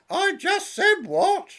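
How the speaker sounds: very high, loud irritated squeak; comic high yelp; exasperated dad. Acted. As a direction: snap back in mock annoyance. Father, annoyed, comic.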